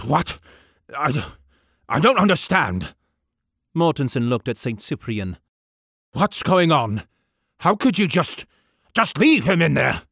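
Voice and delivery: stammering, upset vicar; flustered vicar. Acted. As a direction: falter in disbelief. Vicar confused.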